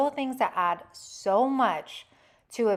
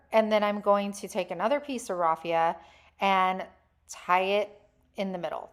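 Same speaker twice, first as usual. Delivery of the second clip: ordinary talk, nothing marked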